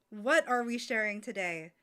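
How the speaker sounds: usual speaking voice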